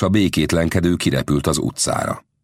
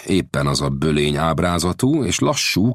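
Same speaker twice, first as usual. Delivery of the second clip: ordinary delivery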